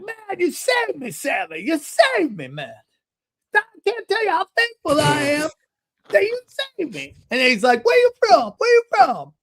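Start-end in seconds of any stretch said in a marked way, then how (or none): 0.0-7.1 put-on high-pitched, effusive old-man impersonation; grateful older man. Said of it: "It's how he talks". 7.8-9.3 put-on loud, very high old-man voice; excitable older man. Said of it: "you sound like Red Fox".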